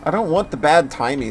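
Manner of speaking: normal talking voice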